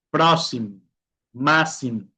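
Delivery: "Próximo, Máximo" with plain s, "prósimo, másimo". Shown as a demonstration. Teacher repeating examples, "Próximo, Máximo" with an S sound. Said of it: "Como una S normal".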